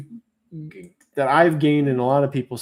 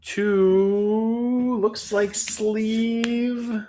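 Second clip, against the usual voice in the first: announcer; 8 semitones higher, slower than usual. Acted. Auction winner announced.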